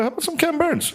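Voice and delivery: high, sing-song innocent suggestion; mock sweet offer. Comic to his yapping date, mock innocent high offer. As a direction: sweetly slip in a sleep cure. Offering Ken Burns to yappers.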